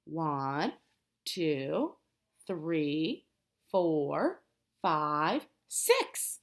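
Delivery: put-on slow, low counting for children to join in; counting aloud. Shown as a demonstration. Teacher counting firefighters with children, slow and low. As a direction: count each number slowly so they can follow. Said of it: "Let's count together".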